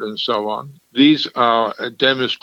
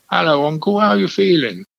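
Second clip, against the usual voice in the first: light imitation of Estuary English, pitched higher; nephew's Estuarian accent; 7 semitones higher than usual. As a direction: mimic a teenage nephew greeting his uncle in Estuary English. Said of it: "adopted what's called Estuarian".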